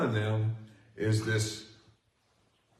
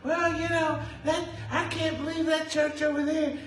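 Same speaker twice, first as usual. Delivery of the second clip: mocking churchgoer. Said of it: "People come to me all the time and say".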